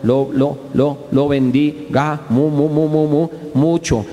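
slow, stammered words, syllables repeated; stuttering. Shown as a demonstration. Preacher imitating a stutterer for the congregation, stammering slowly. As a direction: stammer through each word like a stutterer. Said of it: "Tartamudo habla así".

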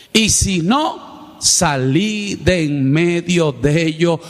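slow preaching at full voice; slow emphatic proclamation. Acted. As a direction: stretch each word to drive the command home.

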